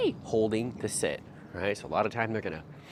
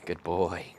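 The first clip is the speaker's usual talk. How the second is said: ordinary delivery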